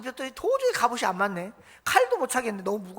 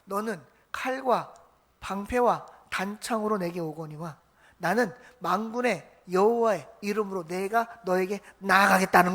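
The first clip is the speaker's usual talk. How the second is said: slow solemn quote; 4 semitones lower than usual. Acted. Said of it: "다윗이 한 말, 유명한 말".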